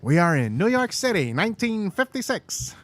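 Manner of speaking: dramatic villain announcing the scene. Pretend. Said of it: "Oh, you sound like the villain".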